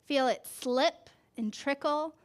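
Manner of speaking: slow, gentle picture-book read; reading to a child. A narrator's reading. Parent reading a flower book to a child, gentle storytelling. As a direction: draw the child into the page.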